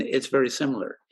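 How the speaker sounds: ordinary delivery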